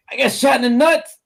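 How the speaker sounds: high, hurting voice, imitated; retold pained complaint. Teller voicing player hit in the groin, mock pained. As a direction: mimic an injured man's high-pitched confession.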